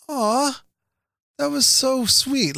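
high, drawn-out "aw" of a touched lady; touched, imitated. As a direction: gush slowly as if melted by a child. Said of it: "turned around and was like".

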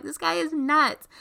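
high, slow, exasperated disbelief; incredulous. Retold or imitated. Narrator watching the creepy ex, high and appalled. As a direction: lift it high and drag it, appalled. Reacting to ex in car.

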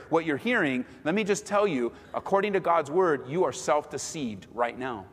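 usual speaking voice

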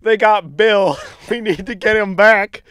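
loud, high mock broadcast alarm; urgent news shout. News reporting Bill's kidnapping, loud mock alarm. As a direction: blurt it out like breaking news. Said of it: "They're gonna say".

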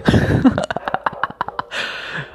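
a high, breathy burst of real laughter. Real, not acted. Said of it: "Oh, I shouldn't laugh".

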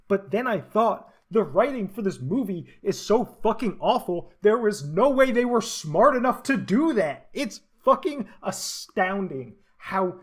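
loud, high, furious rant; angry rant. Real, not acted. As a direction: rant about it at full volume. Ranting about a terrible movie.

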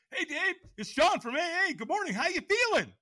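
high, bright cheery sponsor voice; chipper greeting. Retold or imitated. His AA contact calls, cheerful and high. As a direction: sing out a cheerful good morning. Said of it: "He's like".

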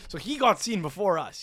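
ordinary delivery

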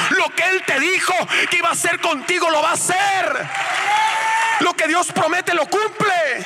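high, shouted pulpit exhortation; full-force preaching. Real, not acted. Preacher rousing the congregation to praise Christ, full-force preaching.

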